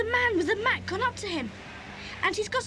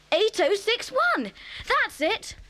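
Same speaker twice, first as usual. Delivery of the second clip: slow, higher-pitched triumphant read-out; excited discovery; 4 semitones higher, slower than usual. Acted. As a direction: read it out as a thrilling find. Finds the number they sought.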